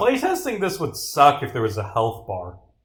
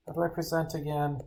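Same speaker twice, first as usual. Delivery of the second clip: hushed sheepish aside; 10 dB quieter, faster than usual. Acted. Detective privately asking how to present, hushed aside. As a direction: mumble it fast under your breath.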